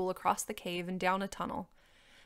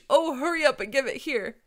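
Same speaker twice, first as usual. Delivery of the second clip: loud, higher, urgent dragon; impatient allergic dragon; 6 semitones higher, 8 dB louder than usual. A narrator's reading. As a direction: hurry them along mid-sneeze.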